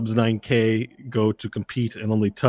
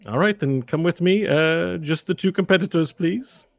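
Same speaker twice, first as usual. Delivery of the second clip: lightly voiced escort, higher pitch; 7 semitones higher than usual. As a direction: usher the competitors along, pitched up.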